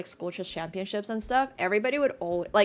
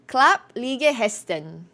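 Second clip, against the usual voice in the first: slow, louder "Klapp lige hesten" in a Nordic accent that slips Norwegian; Scandinavian accent; 4 semitones higher, 6 dB louder, slower than usual. Shown as a demonstration. Streamer tries the Danish phrase for chat, slow Nordic accent. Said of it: "Now I sound Norwegian".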